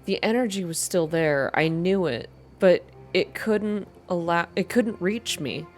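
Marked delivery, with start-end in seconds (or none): none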